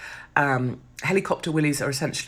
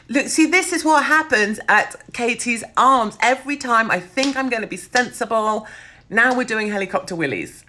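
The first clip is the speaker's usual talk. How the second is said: higher, louder, laughing exasperation; excited; 8 semitones higher, 4 dB louder than usual. Real, not acted. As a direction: throw up your hands, delighted.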